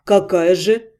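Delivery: low, sullen question; gloomy. A narrator's reading. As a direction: ask it darkly, half-grudging. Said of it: "хмуро полюбопытствовал Вашарий".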